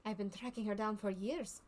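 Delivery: put-on lightly French-accented circus showman. Circus man to the player, explaining his put-on accent in light French accent. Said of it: "I can do French".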